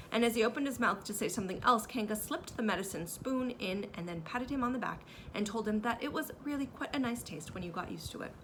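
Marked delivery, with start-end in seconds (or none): none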